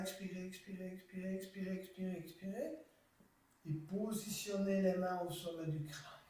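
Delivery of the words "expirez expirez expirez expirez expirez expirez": soft cue. Shown as a demonstration. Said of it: "on monte lentement les mains".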